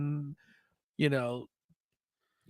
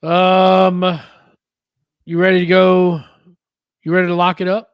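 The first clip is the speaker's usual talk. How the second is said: announcer call; 2 semitones higher, 12 dB louder than usual. Acted. Announcing the start of segment.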